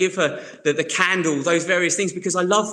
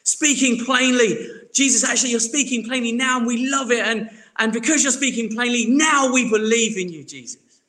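higher and louder, overeager agreement; eager disciples; 6 semitones higher, 4 dB louder than usual. Retold or imitated. Disciples to Jesus, higher louder eager gushing. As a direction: gush like keen disciples who finally get it. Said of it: "they're like".

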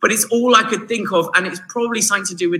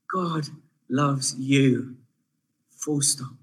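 soft closing line; 6 semitones lower, 8 dB quieter, much slower than usual. Acted. Closing the point softly.

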